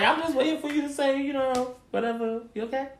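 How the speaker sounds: high, soft, hesitant voice; put-on meekness, pitched well up; awkward mock check-in. Helper waiting on a silent crier, awkwardly tentative.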